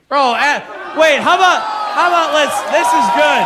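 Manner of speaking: high, loud burst of excitement; worked-up, full-volume interjections; excited shouting. Real, not acted. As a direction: shout over the room, words tumbling out in fragments. Excited outburst at the end.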